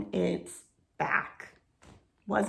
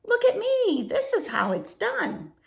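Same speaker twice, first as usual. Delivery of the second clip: higher, louder proud teacher beetle; lightly voiced wise beetle; 13 semitones higher, 3 dB louder than usual. Wise old beetle showing the young one how. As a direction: show off the trick a bit grandly.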